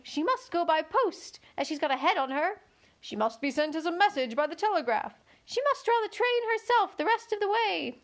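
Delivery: many passengers piling on; chorus of voices. A narrator's reading.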